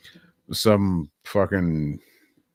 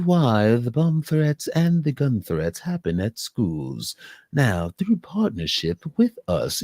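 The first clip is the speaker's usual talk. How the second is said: a hint of a hard sell ad; playful fake infomercial pitch; commercial voice; 7 semitones higher than usual. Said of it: "Do a fake commercial with a commercial voice".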